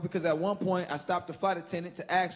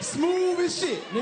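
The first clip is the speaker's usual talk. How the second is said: smug boast; 9 semitones higher than usual. Real, not acted. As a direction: savor it like a slick move.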